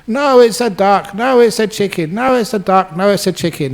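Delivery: hammed-up bickering that runs out of steam; mock quarrel. Acted back-and-forth quarrel.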